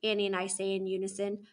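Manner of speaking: ordinary delivery, nothing marked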